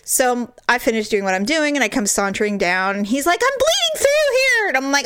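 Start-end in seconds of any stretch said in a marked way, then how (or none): 3.4-4.7 high-pitched imitation of an impatient husband; urgent mock cry. Injured husband calling wife to come look, urgent mock cry. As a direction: call out in mock alarm, voice way up. Retold husband yelling for help.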